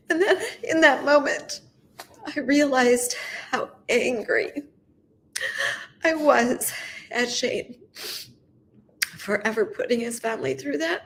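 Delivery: high, halting voice through tears; real crying, strained upward; tearful. Real, not acted. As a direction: keep talking while crying, pausing to recover. Tearful account of forgiving Shane.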